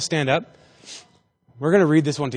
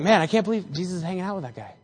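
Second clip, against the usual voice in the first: quick, hushed grumble; retold crowd gossip, kept soft; mock muttering; 8 dB quieter, faster than usual. Townsfolk complaining about Jesus's company, muttering. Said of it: "everybody starts to mutter".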